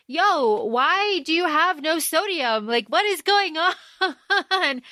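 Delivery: mock incredulity. Retelling a baffled question about sodium, mock incredulity. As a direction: play baffled outrage, breaking into a laugh. Acting out Stroud's baffled confrontation.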